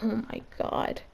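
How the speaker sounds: breathy whispered oh my God; exasperated whisper to yourself; whispered exasperation. Retold or imitated. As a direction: sigh it out under your breath. Exasperated aside under breath.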